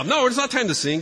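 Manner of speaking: high, urgent objection; mock-frantic, high-pitched no; retold protest. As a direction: act out a flustered dad refusing. Dad argues back over microphone.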